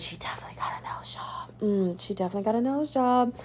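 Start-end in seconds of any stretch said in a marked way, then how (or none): 0.0-1.5 a secretive whispered aside. Real, not acted. Host whispers the nose job gossip to listeners.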